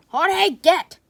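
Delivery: sharp loud command; shouting. Acted. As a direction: yell at the pet to go. Shooing the pet away.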